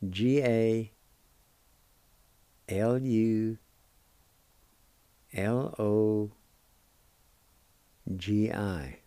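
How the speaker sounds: slow letter-by-letter spelling. Shown as a demonstration. Teacher spelling a word letter by letter. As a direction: dictate each letter with pauses for the learners. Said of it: "And it's spelled like this".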